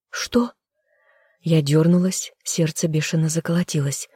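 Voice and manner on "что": startled whispered question, much higher; shocked whisper. A narrator's reading. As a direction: gasp it out in a whisper. Stunned by his question.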